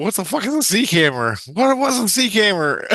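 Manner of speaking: high, incredulous retold question; baffled outcry. Sleeper reacts to an unknown word, baffled outcry. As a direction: blurt it out high, utterly puzzled.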